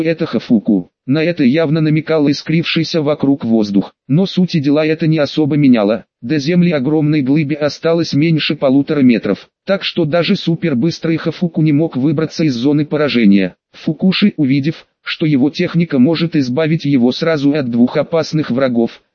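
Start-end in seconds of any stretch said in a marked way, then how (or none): none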